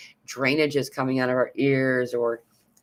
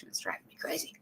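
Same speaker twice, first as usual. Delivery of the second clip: breathy whisper of annoyance; whispered aside; 2 semitones higher, 15 dB quieter, much faster than usual. Real, not acted. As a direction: mutter it under your breath. Complains about an eyelash hair.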